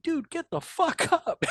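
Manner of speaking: very high, slow disbelieving order; half-laughing, telling him to get up; exasperated order. Retold or imitated. Exasperated order to wounded soldier.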